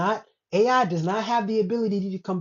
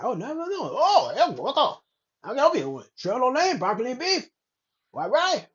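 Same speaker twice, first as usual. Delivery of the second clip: put-on higher-pitched caricature Chinese accent; mock Chinese accent; 4 semitones higher than usual. Imitating a worker taking a shrimp lo mein order, mock Chinese accent.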